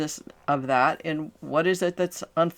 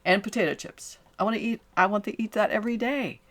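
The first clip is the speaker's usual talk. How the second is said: imitating a client; 6 semitones higher than usual. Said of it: "and go".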